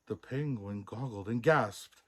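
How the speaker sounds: ordinary delivery, nothing marked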